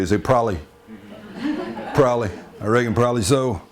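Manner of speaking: probably said as prolly with no b; probably without the b. Shown as a demonstration. Scene: Texan demonstrating how some say probably, no B. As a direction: drop the B, prolly. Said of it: "forget the B entirely".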